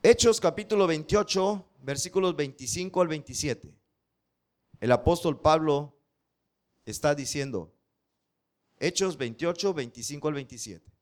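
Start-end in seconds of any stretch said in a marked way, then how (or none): none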